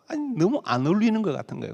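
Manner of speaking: ordinary talk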